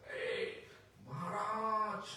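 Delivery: high, loud call-out with a drawn-out name; retold shout. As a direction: imitate someone shouting for the master's attention. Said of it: "he just shout".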